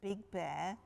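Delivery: usual speaking voice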